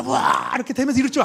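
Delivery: loud wow, then fast, high rush; awed exclaim. Real, not acted. Preacher marvelling at the miracle he expected, fast and loud. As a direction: gasp in wonder and race on.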